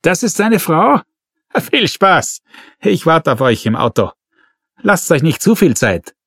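scoffing inspector, pitched high; mocking call-out. A narrator's reading. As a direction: toss a sneer over the shoulder. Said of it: "rief er den beiden Kollegen noch zu".

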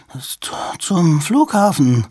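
stammering, high and halting in fright; frozen with fear. A narrator's reading. Terrified Two-Tone answering the stranger, stammering in fear. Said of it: "wurde starr vor Angst".

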